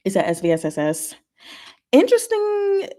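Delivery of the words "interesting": much higher, drawn-up "interesting"; curious, amused "interesting"; intrigued surprise. Real, not acted. Reacting to a chat answer.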